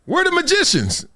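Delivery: put-on high, shouting little boy's voice; high excited child asking his dad; excited kid son. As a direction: squeal it like a thrilled young son. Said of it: "my son's like".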